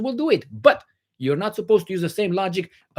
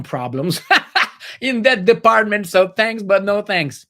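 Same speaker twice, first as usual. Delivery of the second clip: laughing; 6 semitones higher, 6 dB louder, slower than usual. Real, not acted. Joking about own marriage.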